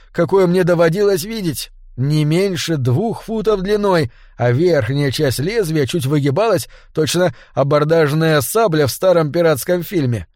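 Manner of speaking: usual speaking voice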